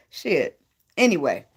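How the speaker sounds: ordinary talk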